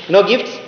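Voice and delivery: high airy laugh at his own joke; high breathy chuckle after a joke; hearty laugh. Real, not acted. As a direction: burst out laughing, amused. Teacher jokes about no gifts.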